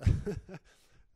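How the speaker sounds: sudden loud chuckle; amused laugh. Real, not acted. Preacher reacting to a strange answer, laughing aloud. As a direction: burst briefly into a real laugh.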